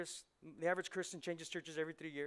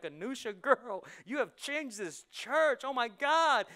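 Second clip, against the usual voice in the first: loud, high, slow gush of praise; 7 semitones higher, 10 dB louder, slower than usual. Pretend. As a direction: rave about her like a proud fan.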